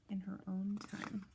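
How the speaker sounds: soft whispered title. Acted. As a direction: breathe the title softly.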